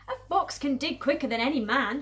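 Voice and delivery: forceful, slightly shouted; mild shout. Acted. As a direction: call it out proudly. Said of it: "shouted Mister Fox".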